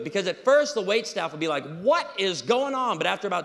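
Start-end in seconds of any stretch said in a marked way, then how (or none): 1.8-3.0 high, loud, drawn-out disbelief; mock bewilderment. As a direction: stretch it like baffled wait staff. Imagined wait staff are bewildered.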